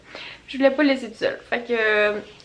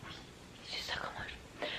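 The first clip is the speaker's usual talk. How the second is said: whisper; 20 dB quieter than usual. Real, not acted. Gronde doucement le chien.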